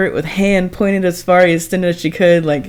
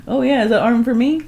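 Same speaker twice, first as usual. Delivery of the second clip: lightly voiced hungry gator, higher; 7 semitones higher than usual. The gator eyeing her arm, lightly voiced hungry creature. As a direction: play the gator eyeing a snack. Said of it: "just looking at her like".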